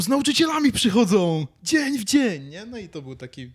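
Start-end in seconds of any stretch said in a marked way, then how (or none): none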